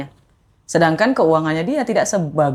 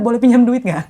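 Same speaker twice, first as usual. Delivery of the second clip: laughing; 4 semitones higher, 4 dB louder than usual. Retold or imitated. Retold plea, laughing at herself.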